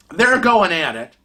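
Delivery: usual speaking voice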